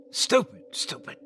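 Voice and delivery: sharp self-reproach, fading on the second word; hissed at myself. A narrator's reading. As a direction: spit it out at yourself under your breath. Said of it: "I hissed at myself".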